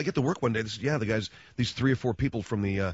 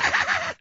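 put-on girlish giggle; 3 dB louder than usual. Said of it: "And she's like".

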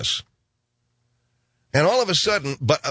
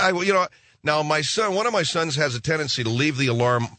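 normal talking voice

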